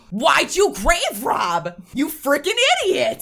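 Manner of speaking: loud, high, drawn-out mock scolding; imitated angry yell. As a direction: bellow it like he's berating foolish kids. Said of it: "when he yells at the kids".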